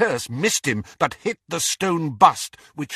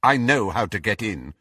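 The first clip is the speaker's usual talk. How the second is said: ordinary delivery, nothing marked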